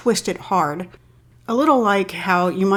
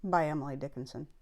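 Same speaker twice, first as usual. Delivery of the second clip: soft, measured poem recital; poetry reading; 13 dB quieter than usual. Acted. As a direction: read the poem quietly, pausing at each break. Reads the poem aloud.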